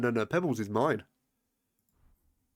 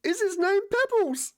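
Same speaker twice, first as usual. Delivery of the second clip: eager high voice; 4 dB louder than usual. Real, not acted. Eager question about the horse.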